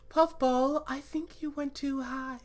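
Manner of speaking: latte's soft high voice. Acted. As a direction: keep it high and gentle. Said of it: "hard to do, like, a soft, high-pitched voice".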